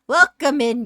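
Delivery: loud, high, hammed-up welcome; mock-gruff greeting. Streamer greeting a silly hooligan viewer, loud and hammed-up. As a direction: belt out the greeting with playful force. Redoes greeting as playful telling-off.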